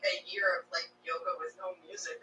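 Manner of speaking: usual speaking voice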